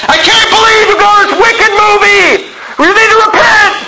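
high, loud mock scolding; mock preacher yell. Mocking preachers outside movie theaters: a high, loud scolding yell. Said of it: "stand outside of movie theaters".

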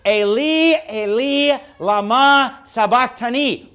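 "Eli, Eli, lama sabachthani" intoned high, with "lama sabachthani" drawn out slowly as lah-mah sah-bahk-tah-nee; Aramaic words. Shown as a demonstration. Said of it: "I get this original language".

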